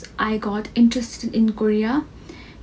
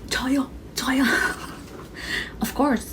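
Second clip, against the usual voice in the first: breathy whisper of 저요, 저요; whispered reply; 3 semitones higher, 6 dB quieter, faster than usual. Retold or imitated. Fan answering Hoshi on stage, whispered. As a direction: whisper it like a shy fan raising her hand. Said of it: "I'm like".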